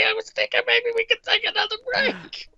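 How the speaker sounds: high-pitched Jersey mom voice; impression of a Jersey mother. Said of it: "doing him as the Jersey Mother".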